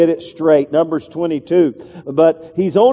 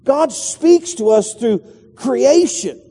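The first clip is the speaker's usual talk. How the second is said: higher, slow, every word weighted; slow emphatic restatement; 6 semitones higher, slower than usual. Shown as a demonstration. Preacher to congregation, slow high emphatic restatement. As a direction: lay it down slowly so it sinks in. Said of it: "preacher restating key point slowly".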